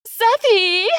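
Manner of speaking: high, sing-out shout; calling out. A narrator's reading. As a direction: call to him from far off, very high. Kylie calls out to Seth.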